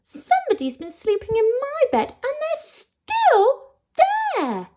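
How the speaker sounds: tiny troll squealing in alarm; Baby Troll. Acted. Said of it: "And Baby Troll says".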